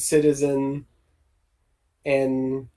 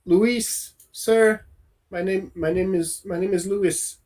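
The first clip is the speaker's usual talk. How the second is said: halting, nervous-sounding stammer; 2 semitones higher, 4 dB quieter than usual. Acted. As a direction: stumble over the words and restart. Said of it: "a little bit of stammering".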